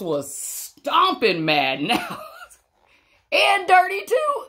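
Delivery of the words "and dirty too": high, laughing through the words. Real, not acted. Reading to kids, amused by the muddy mouse. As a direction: laugh as you say it.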